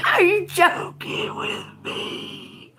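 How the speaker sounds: slow, airy words through laughter; breathless laugh. Real, not acted. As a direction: wheeze it out laughing. Laughing in disbelief.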